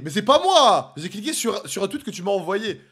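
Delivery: high, shouted self-defence, settling slightly; loud protest. Real, not acted. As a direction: protest your innocence at the top of your voice. Se défend après le son lancé. (protestation criée, voix aiguë)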